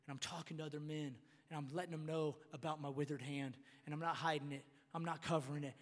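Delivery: soft, low father opening up; acted gentle, vulnerable admission; humble confession. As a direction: confess quietly to the family, without defence. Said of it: "That looks like leadership".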